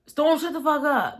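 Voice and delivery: high-pitched imitated telling-off; retold angry outburst. Dennis telling Storm to shut up, retold angry outburst. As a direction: snap it high, mimicking his outburst.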